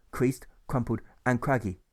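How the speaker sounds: ordinary delivery, nothing marked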